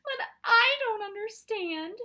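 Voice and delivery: high, loud, drawn-out wail. A narrator's reading. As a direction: wail it slowly like an upset child. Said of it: "she wailed".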